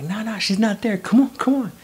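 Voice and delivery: high, urging voice; imitated calling back. Friends urging him over the bridge, imitated calling out. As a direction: hurry him along in a high call.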